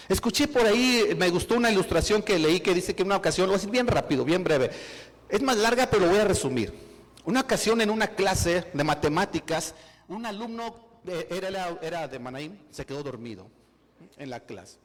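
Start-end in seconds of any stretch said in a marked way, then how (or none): 0.1-9.7 loud, driving preacher's voice; preaching at full force. Acted. Preacher urging the church not to say I can't, preaching loud. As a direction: hammer the point home to the congregation.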